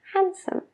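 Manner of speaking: handsome as hansom, high and soft; D dropped. Shown as a demonstration. Teacher showing the fast handsome without the D. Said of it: "handsome se convierte en handsome".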